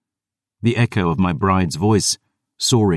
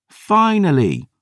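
much higher, slow triumphant crow; girl triumphing over her guardian, much higher; 14 semitones higher, 3 dB louder, slower than usual. Acted. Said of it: "Dior crowed".

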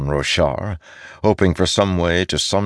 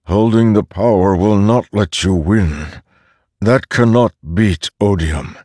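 Stormfather voice; 4 semitones higher, 6 dB louder than usual. A narrator's reading.

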